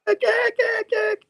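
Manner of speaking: very high, hammed-up wail; choked cries. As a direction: mock-desperate calling while being strangled. Acted choking cries in tentacle struggle.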